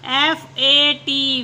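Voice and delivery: slow letter-by-letter spelling. Shown as a demonstration. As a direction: spell A P slowly, each letter apart. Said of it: "teacher spells word slowly".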